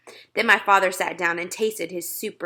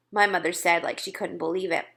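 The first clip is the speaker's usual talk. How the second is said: ordinary delivery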